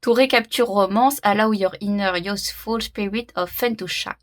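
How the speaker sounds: English read aloud in a French accent; halting French speaker reading English off a card. Real, not acted. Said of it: "vous marrez pas de mon accent anglais".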